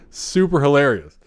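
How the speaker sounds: normal talking voice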